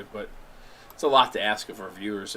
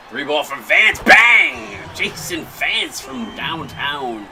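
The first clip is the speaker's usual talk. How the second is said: louder announcer call with a slowed finish; play-by-play call; 2 semitones higher, 6 dB louder, much slower than usual. Acted. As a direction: call the three like a TV commentator. Play-by-play of the game.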